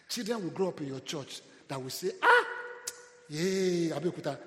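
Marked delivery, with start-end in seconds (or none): 2.2-4.4 put-on excited child's cheer. Children cheering about the church.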